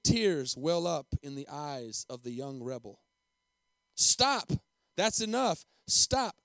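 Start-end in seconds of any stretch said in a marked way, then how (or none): 3.9-6.4 loud, high cries to stop; urgent plea. A narrator's reading. Boy begs as principal takes blows, urgent cry. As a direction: call out to halt the blows. Boy pleads for beating to end.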